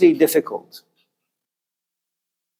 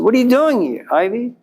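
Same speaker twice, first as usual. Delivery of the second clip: higher, startled question; a friend's surprised higher voice; mock surprise; 7 semitones higher than usual. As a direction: caught off guard at seeing you. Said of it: "He's surprised to see you".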